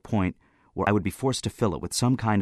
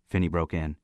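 ordinary delivery

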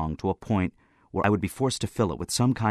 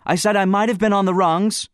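loud, high, insistent outburst; 13 semitones higher, 8 dB louder than usual. A narrator's reading. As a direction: burst out in self-defence, shouting high. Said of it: "I burst in".

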